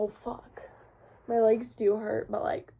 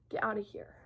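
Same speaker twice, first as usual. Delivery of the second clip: voiceless whispered get outta here; whispered shoo; 8 dB quieter, faster than usual. Real, not acted. Shooing someone off camera in a whisper. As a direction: hiss it quietly off camera.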